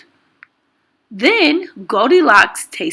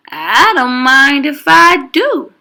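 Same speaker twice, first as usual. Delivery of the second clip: lightly voiced Goldilocks; 5 semitones higher, 5 dB louder than usual. A narrator's reading. Goldilocks eyeing the beds.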